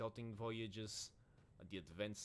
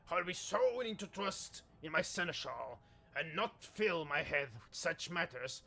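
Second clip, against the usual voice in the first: raised captain voice; 7 semitones higher, 9 dB louder than usual. Acted. Lord Captain snaps back.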